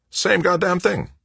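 high-pitched exasperated curse; exasperated repairman, voice way up; worked up. A narrator's reading. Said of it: "He must be really worked up".

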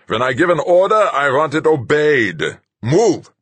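high, loud barked order; commanding shout. A narrator's reading. Captain ordering the crew member off, loud and high.

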